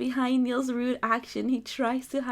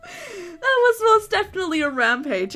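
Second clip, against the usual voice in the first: high, loud laughing; 8 semitones higher, 8 dB louder than usual. Acted. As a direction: laugh as you insist. Laughing retort.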